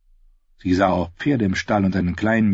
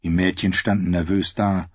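ordinary delivery, nothing marked

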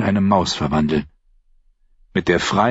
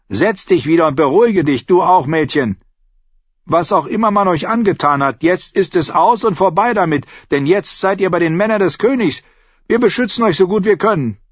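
higher, calming soothe; reassuring; 10 semitones higher, 4 dB louder than usual. A narrator's reading. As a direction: settle them down gently. Schana calms the girls.

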